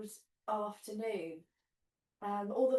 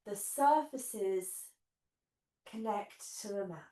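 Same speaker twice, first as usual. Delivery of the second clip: guided meditation. Acted. Leads guided relaxation on mat.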